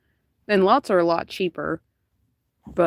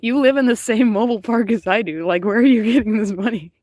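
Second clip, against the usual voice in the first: incredulous; 2 semitones higher, 4 dB louder than usual. Retold or imitated. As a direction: ask it like you can't believe it. Incredulous buyers questioned.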